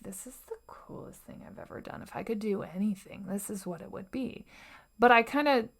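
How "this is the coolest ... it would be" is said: fast, hushed excited whisper; secret whisper. Retold or imitated. Telling herself public speaking is the coolest thing, whispered. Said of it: "meanwhile, I'm like".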